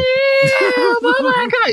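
high, gushing fanboy impression; fangirling falsetto. Friend retelling how he greeted Stamp, fangirling high voice. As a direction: mimic a starstruck fan losing all the bass. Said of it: "He's fangirling over there".

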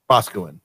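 Boscawen as BOSS-kwin, falling and low; local pronunciation. Shown as a demonstration. Host shows the Maine way, Boscawen as a falling BOSS-kwin. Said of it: "is how that's said".

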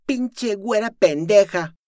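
high, loud, slow furious insult; yelled insult. Acted. The girl screaming at Luzma, furious. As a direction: scream it at her. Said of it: "gritó la joven".

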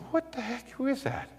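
inner thought. Retold or imitated. Preacher hears a voice, soft puzzled inner thought. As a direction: wonder to yourself under your breath. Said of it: "I'm thinking".

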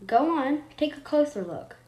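lightly voiced beaming mom; beaming mother. A narrator's reading. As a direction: coax her closer with pride. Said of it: "Miss Caldwell said, beaming".

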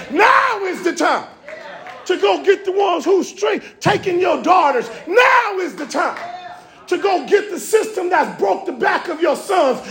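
whooping sermon climax; preaching at full force. Acted. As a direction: hammer each "Now is the time". Preacher's rising refrain.

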